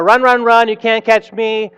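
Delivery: lightly played runaway's taunt; taunting Stinky Cheese Man. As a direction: call it out to cue a crowd. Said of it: "let's practice one more time".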